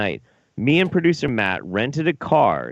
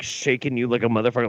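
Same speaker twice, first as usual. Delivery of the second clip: usual speaking voice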